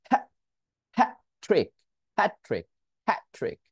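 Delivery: "hat" broken off before "trick"; stopped T. Shown as a demonstration. Teacher repeating the model for students, stopped T. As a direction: hold the T of "hat" unreleased. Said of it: "উচ্চারণে আটকে গেছি".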